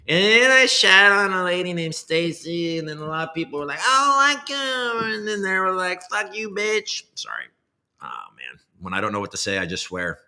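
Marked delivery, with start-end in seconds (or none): 0.1-7.0 high put-on coin dev voice. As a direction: ham up the goofy developer persona, stretched out. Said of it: "spouting off in that voice".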